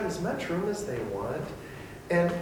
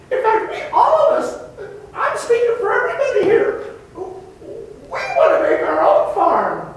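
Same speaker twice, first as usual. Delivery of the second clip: very high squeaky indignant ant voice; rebel ant; 10 dB louder than usual. Acted. Ant leader defying its farm owner, very high and indignant. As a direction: squeak it like a defiant little ant. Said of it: "the ant is going to say to you".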